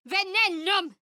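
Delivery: high venomous spell cry; spell incantation. Acted. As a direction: fling the poison word. Casting a spell in combat.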